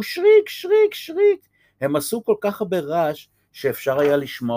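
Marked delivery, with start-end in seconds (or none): 0.0-1.5 very high squeaky guinea pig "שריק"; guinea pig squeak. Acted. Hungry guinea pigs squeaking for food, very high. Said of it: "ושרקקו".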